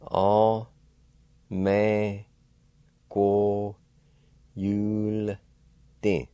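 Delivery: All, me, go, yul, din, each syllable apart, yul said clearly; slow teaching syllables ending in yul, din. Shown as a demonstration. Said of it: "pronounce the L sound with the Y U like this".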